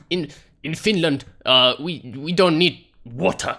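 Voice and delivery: halting, badly faked Finnish accent. Thirsty gamer joking about drinking water, bad Finnish accent. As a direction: attempt a clumsy Finnish accent. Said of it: "that was a bad Finnish accent".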